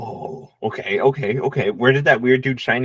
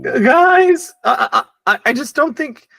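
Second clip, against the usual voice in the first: panicked call; 10 semitones higher, 5 dB louder than usual. Acted.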